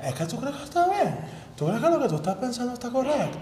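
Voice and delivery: imitating a Venezuelan sweet-talker; Venezuelan accent. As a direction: tease it like a flirty Venezuelan man. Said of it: "me encanta cuando dicen".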